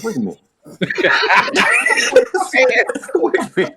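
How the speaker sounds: high cracking laughter; laughing. Real, not acted. Storyteller cracking up at his own story, laughing. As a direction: break up laughing mid-line.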